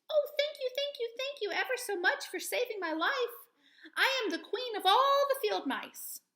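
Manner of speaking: high, tiny squeak of a grateful mouse; squeaky mouse voice. Acted. Mouse queen thanks the Woodman, high squeaky voice. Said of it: "The mouse stopped and squeaked".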